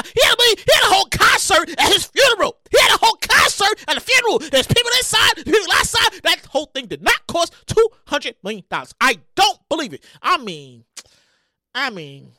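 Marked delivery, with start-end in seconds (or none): 0.0-6.2 high, loud incredulous rant; worked-up shouting, pitched high; yelling. Real, not acted. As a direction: yell the argument into the microphone. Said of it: "I start yelling on the microphone".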